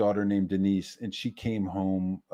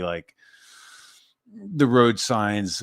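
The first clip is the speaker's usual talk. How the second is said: ordinary talk, nothing marked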